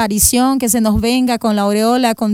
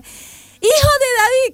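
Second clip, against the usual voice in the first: very high, drawn-out call; high, stretched beggar's cry, held back; attempted shout; 13 semitones higher, slower than usual. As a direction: holler from back off the microphone. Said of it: "quiero gritar".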